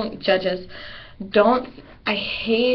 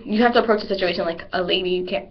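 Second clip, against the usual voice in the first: ordinary talk, nothing marked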